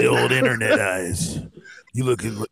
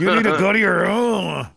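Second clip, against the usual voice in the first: high, laughing ventriloquist dad; mock a dad's order through a laugh; 8 semitones higher than usual.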